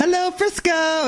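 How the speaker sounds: very high, drawn-out greeting; "Hello, Frisco" impression. Friend imitating the Dodger fan's catchphrase, high drawn-out impression. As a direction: stretch it out in a squeaky-high put-on voice. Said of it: "he does a great, like".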